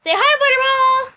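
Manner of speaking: high sing-song call to the pet. Real, not acted. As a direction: coax the pet to say hi. Calling to the pet.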